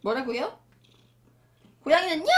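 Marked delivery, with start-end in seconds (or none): 2.2-2.3 high mock meow; cat meow demo. Shown as a demonstration. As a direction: show how a cat should meow. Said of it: "이렇게 울어야죠".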